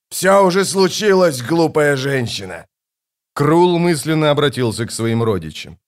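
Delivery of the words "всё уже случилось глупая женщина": furious tirade. Acted. Kallor blames the gods for the ashes, furious shouting. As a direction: hurl the blame at them.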